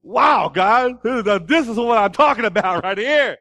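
loud, high amazed shout, slowing at the end; Adam thrilled at the woman God made, loud delight; awestruck excitement. Retold or imitated. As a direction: marvel out loud like a man overwhelmed.

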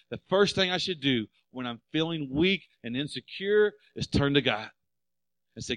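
loud, high preaching at full force. Acted. Pastor preaching hard to the congregation, loud and high. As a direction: preach it out to the church.

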